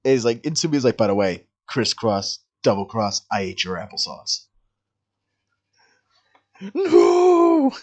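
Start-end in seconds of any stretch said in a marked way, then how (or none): 1.0-4.5 low, quieter character voice; mock villain reveal. Retelling a character's taunting betrayal line. 6.7-7.7 long, high dramatic wail; hammed-up cry of betrayal; mock anguished cry. Acted outcry at the betrayal.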